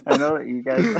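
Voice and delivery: words squeezed out between giggles; trying not to laugh. Real, not acted. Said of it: "Quit laughing".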